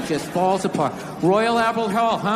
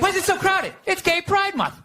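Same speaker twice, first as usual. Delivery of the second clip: much higher, realizing it mid-crowd; high mock voice; 10 semitones higher than usual. Comic asking why the street is crowded, high mock voice. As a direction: squeak it out in a high put-on voice. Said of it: "It's like".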